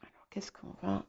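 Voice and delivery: hushed, near-whispered aside; murmuring to oneself. Real, not acted. Reader to self while drawing the star card, murmured.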